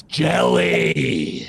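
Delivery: drawn-out drill-sergeant correction; company commander's order. Retold or imitated. Company commander in the recruit's face, drawn-out order. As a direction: stretch the word out in his face. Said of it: "looks him right in the face and says".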